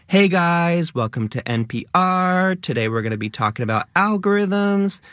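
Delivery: high, upspoken influencer intro with rising tone; lifestyle influencer voice. Shown as a demonstration. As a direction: chirp it like a lifestyle YouTuber greeting fans. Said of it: "It has that up talk".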